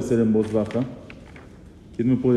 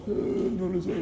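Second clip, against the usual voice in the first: hoarse voice; 6 semitones higher, 8 dB quieter than usual. Shown as a demonstration. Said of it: "está ronco".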